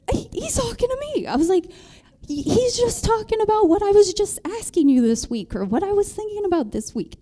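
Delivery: high, breathless excited rush; excited disbelief. Retold or imitated. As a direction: gush it out, amazed he's speaking to you. Excited retelling to husband.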